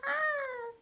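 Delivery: very high cooing at the dog; squealing high over a dog; mock cooing girl. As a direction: coo like an overexcited visitor. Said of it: "she gets all, like, emotional to my dog".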